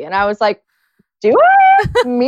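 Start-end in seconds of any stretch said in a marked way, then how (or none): 1.2-2.3 very high coy squeal; feigned innocence. Retold or imitated. Dressed-up employee answers boss's compliment, coy mock innocence. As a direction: play hammed-up fake modesty, way up high.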